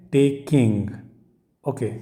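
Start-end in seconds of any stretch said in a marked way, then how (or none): 0.1-0.9 टेकिंग split toward "tek-king"; pronunciation model. Shown as a demonstration. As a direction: model the "ing" ending in टेकिंग. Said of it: "जैसे".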